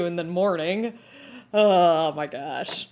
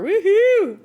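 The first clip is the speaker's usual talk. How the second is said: high, loud woohoo; cheer; 13 semitones higher, 8 dB louder than usual. Real, not acted. Streamer cheering the Australian viewers joining, loud high whoop. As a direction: whoop to welcome them in.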